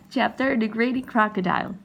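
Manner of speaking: plain reading voice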